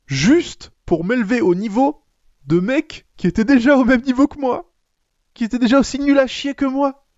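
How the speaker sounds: high, building outraged rant; comic indignation. Real, not acted.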